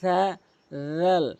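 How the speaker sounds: সা with a voiceless th and জাল with a voiced th, tongue tip to teeth; teacher's recap of সা and জাল; Arabic letters demo. Shown as a demonstration. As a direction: say সা then জাল, each a careful th sound. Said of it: "তাহলে".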